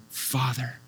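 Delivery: soft, low, reverent word; hushed, intimate naming; tender address. Retold or imitated. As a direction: say it gently, as a prayer. Says Father in grateful prayer.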